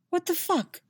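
sudden high burst of shock; stunned disbelief. A narrator's reading. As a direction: snap out of it in disbelief. Yennefer's stunned inner thought.

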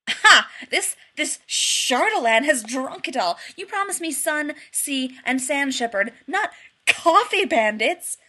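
loud, high indignant rant; splutter, then build to a loud accusation; outraged. A narrator's reading. Outraged rant about stolen coffee.

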